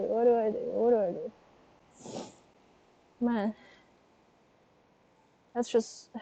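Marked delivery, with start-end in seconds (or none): 0.1-2.2 softer and softer until whispered; fading to a whisper. Real, not acted. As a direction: let the panic trail off into a whisper. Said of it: "I'm so self-conscious".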